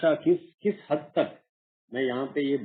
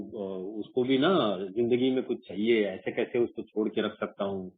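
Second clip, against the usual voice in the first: usual speaking voice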